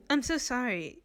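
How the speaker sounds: Renza voice not quite found; a first miss at Renza's voice; wrong voice. Shown as a demonstration. GM trying Renza's voice, not yet right. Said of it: "no, that's not the voice".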